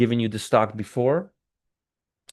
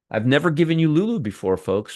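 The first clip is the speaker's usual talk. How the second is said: ordinary talk, nothing marked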